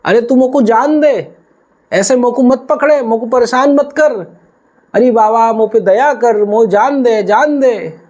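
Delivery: put-on high, desperate boy begging to be let go; a frightened boy trying to get home to his mother; pleading cowherd boy. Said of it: "वो ग्वाल बोले".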